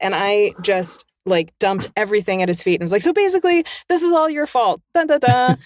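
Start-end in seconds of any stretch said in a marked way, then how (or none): 3.0-5.6 high, gushing playful blame; mock accusation. Fan blaming her idol for her career, mock-dramatic. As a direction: pitch it high, ending on a melodramatic sting.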